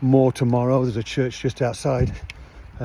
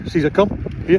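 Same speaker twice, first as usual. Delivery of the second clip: raised, higher call to the dog; 5 semitones higher, faster than usual. Real, not acted. Owner calling his dog back across the car park, raised voice.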